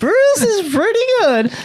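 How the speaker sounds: loud, slow, very high mock voice; Tony Romo. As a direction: push it way up into a hammed-up falsetto. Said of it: "that's my Tony impression".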